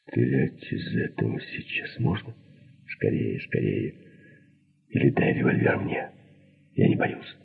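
hushed, breath-only urging; soft, secretive pleading for action; urgent whisper. A narrator's reading. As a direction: whisper it insistently to an accomplice. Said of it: "прошептала Алиса".

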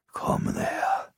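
slow, soft dying rasp; rasping whisper. A narrator's reading. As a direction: pull him closer with your last breath. Dying grandpa summons boy. (langsames, heiseres Sterbeflüstern)

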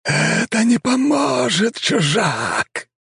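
high, slow, otherworldly vampire drawl; a voice from beyond the grave; sepulchral voice. A narrator's reading. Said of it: "протянуло пространство всё тем же замогильным голосом".

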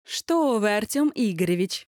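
high, sugary, innocent lilt; sweet sing-song. Acted. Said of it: "пропела милым голосом".

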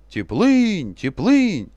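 loud chanted shout; battle cry. Retold or imitated.

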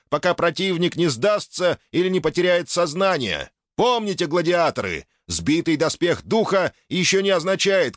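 booming prize announcer. Acted. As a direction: announce the rules to the stands. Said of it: "ведущий на трибуне разливается соловьём".

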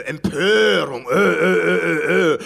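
mock outrage noise; outraged Ö sound. Shown as a demonstration. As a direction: grunt out Ö like a worked-up grumbler. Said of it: "das ist so ein Ö".

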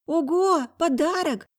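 high delighted exclamation; excited exclaim. A narrator's reading. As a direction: gasp it out with glee. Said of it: "воскликнул он".

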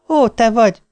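light up as you recognise the caller; brightening voice. Retold or imitated. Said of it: "színesedett meg a hangja".

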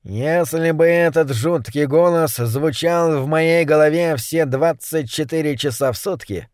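ordinary delivery

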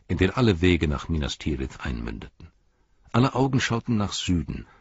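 plain reading voice

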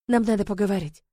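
ordinary delivery

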